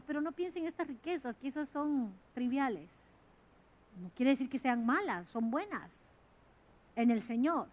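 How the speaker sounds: normal talking voice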